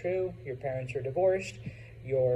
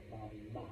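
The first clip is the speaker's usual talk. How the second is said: very soft, low "Mama" as ma-MA; Spanish "Mama", weight on the second syllable; stress on last syllable; 5 semitones lower, 14 dB quieter than usual. Shown as a demonstration. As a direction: quietly model "Mama" with the final A stressed. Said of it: "Pronounce that last A".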